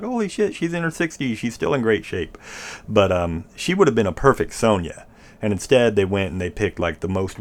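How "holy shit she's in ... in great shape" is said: much higher, amazed exclamation; amazed reaction. Retold or imitated. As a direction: gush like seeing her photo again. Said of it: "I was like".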